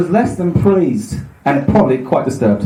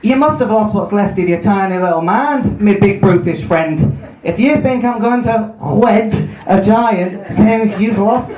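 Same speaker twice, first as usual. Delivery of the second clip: badly faked Scouse, pitched up; Scouse accent; 4 semitones higher, slower than usual. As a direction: attempt a hammy Liverpool accent.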